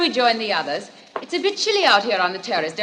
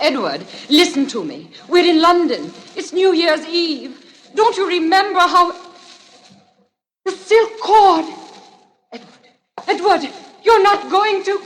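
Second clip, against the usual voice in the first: higher and louder, rising panic; desperate pleading; 7 semitones higher, 4 dB louder than usual. Acted. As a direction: beg him to stop. Woman pleading with strangler.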